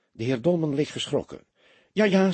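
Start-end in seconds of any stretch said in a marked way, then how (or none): 1.9-2.3 flustered yes, yes, caught off guard; nervously hesitant agreement. A narrator's reading. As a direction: hedge it, unsure of yourself. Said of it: "zei hij weifelend".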